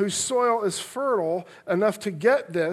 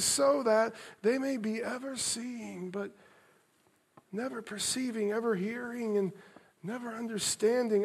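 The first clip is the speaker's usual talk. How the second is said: sad and tender; 8 dB quieter than usual. Shown as a demonstration. Preacher rereading Isaiah's words for the congregation, soft and grieving. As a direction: let the words ache with compassion. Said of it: "Hear them with an inflection of sadness, of compassion, of love".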